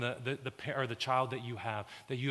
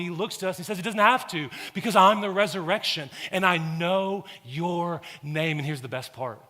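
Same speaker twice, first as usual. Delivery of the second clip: loud, high, building sermon climax; full-force preaching; 6 semitones higher, 10 dB louder than usual. Acted. Pastor pleading with the congregation, full-force preaching. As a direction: preach it out with rising fire.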